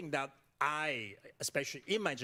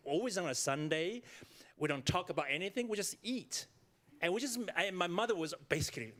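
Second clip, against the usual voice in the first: normal talking voice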